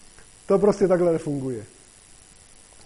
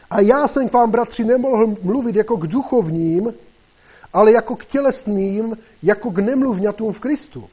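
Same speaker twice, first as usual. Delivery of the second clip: raised, higher-pitched Bible reading; scripture reading aloud; 5 semitones higher, 4 dB louder than usual. Real, not acted. Preacher reading Corinthians to the congregation, emphatic read-aloud. Takže já vám to přečtu.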